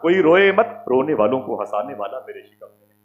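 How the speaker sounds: loud, high preaching cry; full-force preaching. Acted. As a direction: proclaim it to the whole crowd. (ज़ोरदार तक़रीर)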